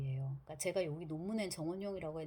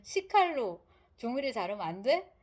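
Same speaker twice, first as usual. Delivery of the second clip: louder mock-indignant comeback, rising sharply at the end; indignant retort; 3 semitones higher, 6 dB louder than usual. Rogozhin retorting about the knife to his questioner, indignant. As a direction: throw it back as a challenging question. Retold character's retort, repeated with emphasis.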